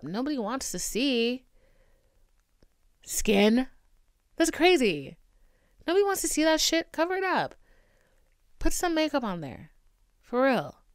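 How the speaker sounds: high, loud sarcastic scolding; mocking loud rant. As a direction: shout it like a pushy critic. Mocking beauty standard demands.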